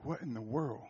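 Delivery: very soft, low retold reaction; quietly taken aback; hushed disbelief. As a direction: murmur it low, as a stunned aside. Stunned aside in a sombre story.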